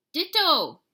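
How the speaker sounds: high, loud reply; declared. A narrator's reading. Martian twin answering the kitty boy, high and loud. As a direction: fire it back. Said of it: "declared Scapito's Martian twin".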